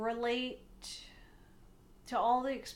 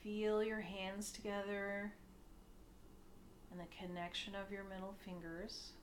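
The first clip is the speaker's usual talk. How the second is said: soft meditation voice with long pauses, growing quieter; hushed, unhurried guiding, sinking softer as it goes; guided meditation; 3 semitones lower, 10 dB quieter than usual. Acted. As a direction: ease them gently into stillness.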